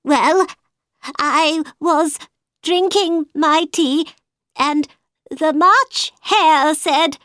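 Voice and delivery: high, slow hatter; high nervous hatter; Mad Hatter voice. A narrator's reading.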